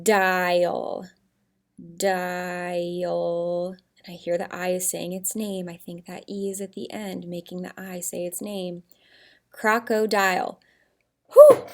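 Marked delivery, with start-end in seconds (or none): 0.0-3.7 "Dial" drawn out slowly and low; sounding out. Shown as a demonstration. Teacher working on the last syllable, "Dial" stretched very slowly. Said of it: "I'm focusing on that last syllable". 11.3-11.7 loud, high exhaled "whew" of relief; relieved whew. Pretend. Teacher finishing a hard spelling for her class, relieved whew.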